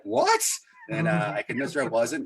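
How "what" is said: high, loud mock-shocked yelp; hammed-up shock, pitched way up. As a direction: blurt it out in disbelief. Retold shock at wife's question.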